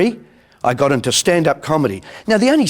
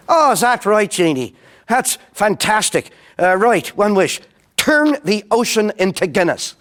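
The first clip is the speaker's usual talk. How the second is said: higher, eager Irish voice; Irish feller; 5 semitones higher than usual. Retold or imitated. Irishman makes his wish to the genie, eager and high. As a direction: jump at the chance of a wish. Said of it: "Two Irish fellers".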